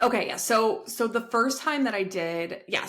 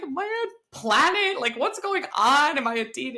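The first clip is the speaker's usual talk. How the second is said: retold baffled outburst, pitched up; bewildered exclamation; 7 semitones higher than usual. Acts out her bewildered texts to friend.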